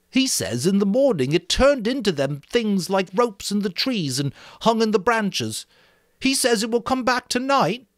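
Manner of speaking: Piggy relaying the fear; boy Piggy, worried. Acted. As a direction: press the child's fear. Piggy voiced.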